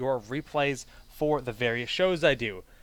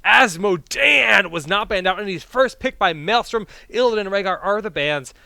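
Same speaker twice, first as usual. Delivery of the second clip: loud, excited cast call; play-by-play; 7 semitones higher, 10 dB louder than usual. Acted. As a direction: hype the draft picks. Live draft play-by-play.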